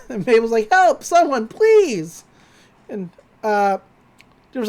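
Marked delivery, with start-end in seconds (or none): 0.7-2.2 high, loud mock cry for help; calling for help. Mabel calling out for rescue, high and loud. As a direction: yell it like she's trapped.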